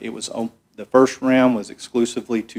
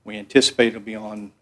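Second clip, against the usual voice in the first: usual speaking voice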